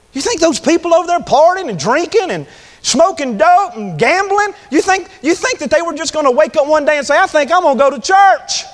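high-pitched, urgent preaching; fervent preaching. Real, not acted. Preacher raising his voice.

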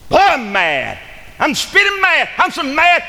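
loud, high yell of hammed-up rage; furious mock rant. Preacher acting out Jacob's wrath, hammed-up furious yelling. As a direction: explode as an outraged father, pitch way up. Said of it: "he got mad".

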